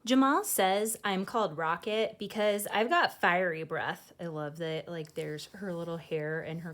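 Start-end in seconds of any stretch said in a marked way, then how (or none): 0.0-4.1 put-on lively read-aloud voice for children; storybook reading. A narrator's reading. Host reading a picture book to kids, lively storytelling. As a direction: read it like bedtime story time.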